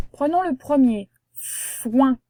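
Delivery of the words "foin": "foin" with a long held F; sounded out. Shown as a demonstration. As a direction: stretch the F of "foin", then release "ouin". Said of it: "On entend f et ouin".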